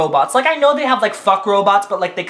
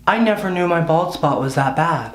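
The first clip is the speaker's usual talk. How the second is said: normal talking voice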